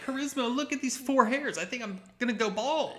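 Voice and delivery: high-pitched, hammed-up alarm; mock panic. Man fretting to partner about going bald, mock panic.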